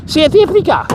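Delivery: "South Africa" said as "Sith Efrica" in a fake South African accent; bad South African accent. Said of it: "in the worst ever South African accent possible".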